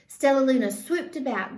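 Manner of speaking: plain reading voice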